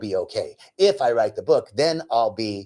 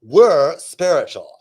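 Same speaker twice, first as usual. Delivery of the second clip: slow, louder, affected self-praise; mock neighbor talk; 2 semitones higher, 8 dB louder, slower than usual. Neighbor praising herself to her friend, mock-smug. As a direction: imitate a smug neighbor, drawing it out. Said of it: "the one woman says".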